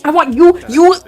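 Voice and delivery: shouting high and loud at the officer; a proud father raging at the police; furious father. Acted.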